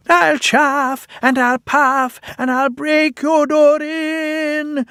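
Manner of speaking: very high, slow, drawn-out menace; ghost threatening. Pretend. Percy as ghost threatening to break the shed door. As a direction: huff like the big bad wolf. Percy plays the big bad wolf.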